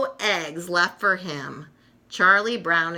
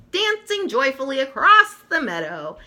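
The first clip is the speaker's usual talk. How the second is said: usual speaking voice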